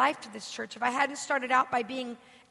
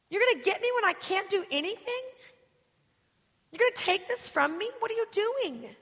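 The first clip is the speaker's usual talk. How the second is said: retold indignant plea, climbing higher; angry pleading with God; 5 semitones higher than usual. Woman retells challenging God through tears, angry pleading. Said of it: "I'm talking to him strongly".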